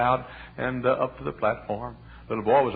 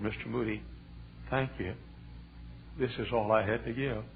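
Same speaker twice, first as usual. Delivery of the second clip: put-on soft little boy's note; 9 dB quieter than usual. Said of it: "note from the little boy who said".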